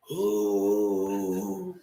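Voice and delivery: low mock dog moan; low hammed-up whimper. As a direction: moan like a sick bulldog. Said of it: "the dog was moaning like".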